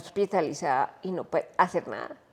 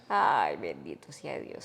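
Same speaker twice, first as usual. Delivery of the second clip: heavy sigh of dismay; 12 semitones lower, 6 dB quieter, slower than usual. Retold or imitated. Recalling the tumor diagnosis.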